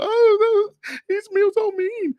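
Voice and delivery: very high mock falsetto wail; overemotional whining. Women upset at rough guy talk, mocking high emotional whine. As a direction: wail it like a hammed-up crybaby. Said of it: "all emotional".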